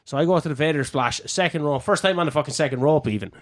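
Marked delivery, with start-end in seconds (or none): none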